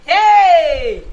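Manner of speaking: very loud, drawn-out exclamation; shocked cry. Acted. Madam reacting to people dying, shocked cry. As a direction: wail "Hey!" in loud dismay.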